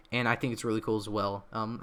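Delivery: normal talking voice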